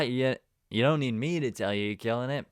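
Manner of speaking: ordinary delivery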